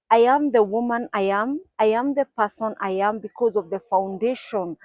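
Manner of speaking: normal talking voice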